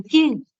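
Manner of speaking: "Quién" high and loud, then falling; exclamatory tone. Shown as a demonstration. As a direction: exclaim "Quién" as a whole sentence. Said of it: "puedo decir como una oración". ("Quién" exclamado, alto y fuerte)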